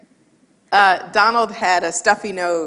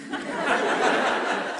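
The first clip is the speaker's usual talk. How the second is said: quiet breathy laugh; 7 dB quieter than usual. Real, not acted. Laughs at own joke.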